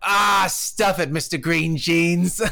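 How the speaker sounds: gruff retort. Retold or imitated. Old guy in his boat yelling at the Winnebago driver, slow mock shout. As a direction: bark the insult slowly like a grumpy old guy. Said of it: "And he goes".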